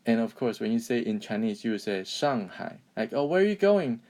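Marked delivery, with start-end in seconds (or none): 3.1-4.0 high, louder role-play question; raised-pitch acted inquiry; acted curious question. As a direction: play someone asking a passer-by. Acted question in imagined exchange.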